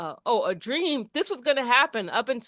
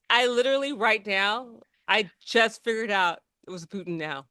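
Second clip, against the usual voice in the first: normal talking voice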